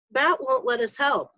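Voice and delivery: ordinary delivery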